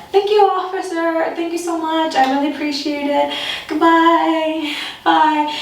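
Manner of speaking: high, overly polite thanks; high-pitched forced politeness; polite farewell. Retold or imitated. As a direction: sound cheerful to get away. Polite goodbye to the cop.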